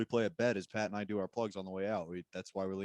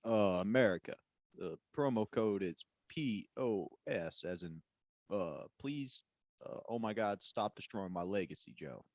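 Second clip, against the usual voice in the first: Barack Obama. Acted. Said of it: "[name], can you do it?".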